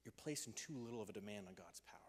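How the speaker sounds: hushed whisper, barely voiced. Real, not acted. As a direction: lean in and whisper it to the room. Sermon drops to a hush.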